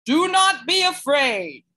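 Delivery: a strange voice calling from the woods. Acted. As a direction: reassure them from the woods, drawn out. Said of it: "a voice called to them".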